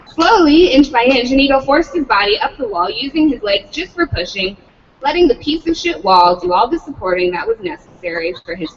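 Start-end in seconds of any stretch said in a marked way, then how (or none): none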